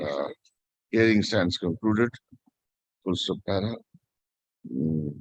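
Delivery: low, measured dictation with spoken punctuation; dictating a court order. Real, not acted. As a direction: dictate the order clause by clause for the stenographer. Judge dictating order to stenographer.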